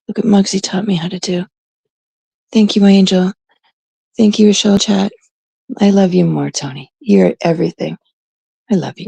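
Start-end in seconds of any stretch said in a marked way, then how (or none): none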